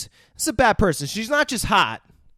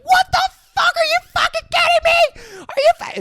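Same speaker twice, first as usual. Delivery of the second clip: much higher, louder shrieking disbelief; Ellie outraged; 7 dB louder than usual. Retold or imitated. Friend retelling Ellie's outburst on the phone, high outraged shriek. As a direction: squeal it in mock outrage. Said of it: "Ellie is like".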